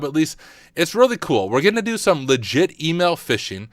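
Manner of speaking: upbeat high YouTube intro; bright, excited channel greeting; welcome voice. Acted. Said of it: "my welcome voice".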